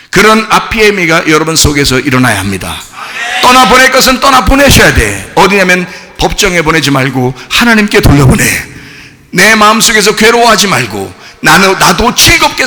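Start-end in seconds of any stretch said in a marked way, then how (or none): none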